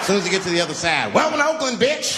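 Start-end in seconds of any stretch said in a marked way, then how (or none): 1.1-2.2 high, brash mock welcome; acted welcome yell. Oakland greeting a new arrival, brash high call. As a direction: holler it out as a rough greeting.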